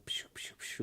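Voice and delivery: soft voiceless "psh, psh, psh"; three quick hushed whooshes; whooshing noise. Shown as a demonstration. Imitates noise while explaining.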